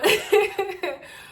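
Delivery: high-pitched nervous-sounding giggle. Real, not acted. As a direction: giggle before going on. Laughs before resuming the story.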